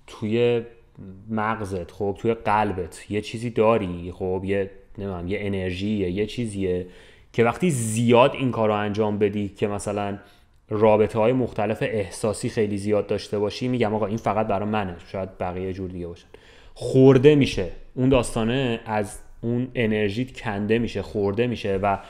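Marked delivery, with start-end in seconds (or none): none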